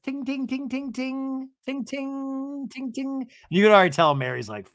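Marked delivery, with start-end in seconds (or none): 0.0-3.3 high, softer "ting" noises; imitated glass clinking. Brittany calls for a toast, high imitated clinking. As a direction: ring it out like a tapped glass.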